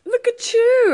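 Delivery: excited. Acted. Greeting callers with mock delight.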